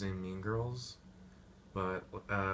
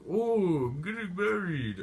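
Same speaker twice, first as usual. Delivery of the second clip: high, loud silly gushing voice; hammed-up high-pitched Watson; mock Watson voice; 10 semitones higher, 5 dB louder than usual. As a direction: squeal it like a giddy groom. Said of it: "Watson is all like".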